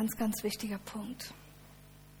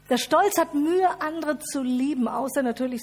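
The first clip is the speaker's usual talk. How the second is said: pride voiced loud; 3 semitones higher, 7 dB louder than usual. Pride contrasted loud with humility.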